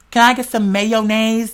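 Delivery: slow, over-proper "mayonnaise" in full syllables. Retold or imitated. Imitating people who say it proper, slow careful "mayonnaise". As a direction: draw out "mayonnaise" as may-o-naze. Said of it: "When you hear people say it, like, proper".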